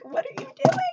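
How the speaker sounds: high, squeaky self-scolding voice; inner Virgo self. Acted. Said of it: "My Virgo self is like".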